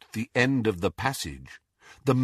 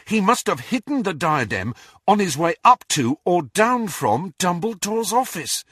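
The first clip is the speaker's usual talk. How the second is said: raised pitch, piecing it together eagerly; excited realisation; 7 semitones higher than usual. A narrator's reading. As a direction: reason aloud with mounting excitement. Harry excitedly works out the clue.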